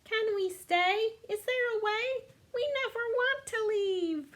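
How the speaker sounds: small kittens pleading to stay on the island. A narrator's reading. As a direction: beg to stay like tiny kittens. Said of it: "the kittens each arose and added pleas".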